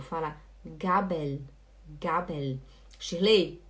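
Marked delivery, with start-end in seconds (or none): 0.6-2.6 Gabel lower and softer, clear L at the end; correct final L. Shown as a demonstration. Teacher leading a student to repeat, clear final L. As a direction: model Gabel quietly, tongue up on the L. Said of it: "repete comigo".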